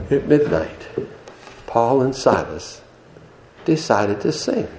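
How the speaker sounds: soft, spaced-out phrases with long pauses; hushed storytelling. Acted. Preacher setting the midnight prison scene, hushed. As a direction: drop to a hush, as if in a silent prison.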